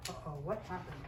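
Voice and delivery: low, very soft uh-oh; soft, low storyteller's question; hushed suspense. Acted. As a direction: drop your voice for a worried aside.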